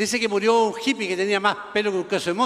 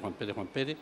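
much lower, hushed muttering; absent-minded low murmur; muttered list-checking; 11 semitones lower, 13 dB quieter than usual. Acted. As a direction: repeat the name under your breath as you search. Devil searches his list.

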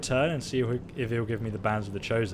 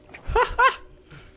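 shrieking high laugh; high burst of laughter; 6 dB louder than usual. Real, not acted. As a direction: crack up in a high squeal.

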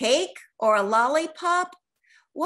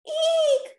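shrill little eek, much higher; high screech; 4 dB louder than usual. A narrator's reading. Genie frightened in the sky, screeching. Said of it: "Rocky screeched".

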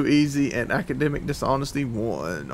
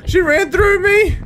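high, loud disbelieving cry; shocked; 13 semitones higher, 7 dB louder than usual. Real, not acted. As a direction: yelp it in shock, pitch climbing. Monster ran through him.

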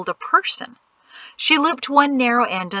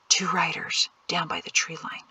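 hushed warning; 6 dB quieter than usual. A narrator's reading. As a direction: warn quietly so nobody overhears. Hushed warning of riders nearby.

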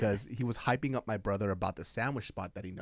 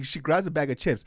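normal talking voice

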